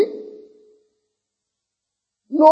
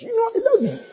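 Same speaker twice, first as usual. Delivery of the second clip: high, mocking imitation of dad mumbling it; hammed-up mimic of father; 9 semitones higher, 9 dB quieter than usual. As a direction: parody the father's offhand love line. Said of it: "It's like an utterance".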